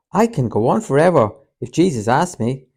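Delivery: loud, high, fervent cry; eager shout. A narrator's reading. James eagerly answers the others, loud and fervent. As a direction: call it out with youthful fervour. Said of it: "shouted James".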